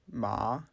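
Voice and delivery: MA rising in pitch; second tone. Shown as a demonstration. Teacher gives the word for hemp, "ma" rising. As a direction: glide "ma" upward. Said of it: "The second tone".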